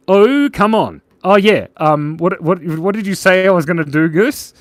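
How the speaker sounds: thrilled at the chat; hyped-up reaction to the likes; excited. Real, not acted.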